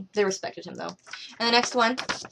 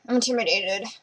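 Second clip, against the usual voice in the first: a hammed-up "oh no", higher and louder; young midshipman mocking his own first-day fear; mock timid Horatio; 4 semitones higher, 4 dB louder than usual. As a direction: play the nervous new midshipman as a joke. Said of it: "he's all like".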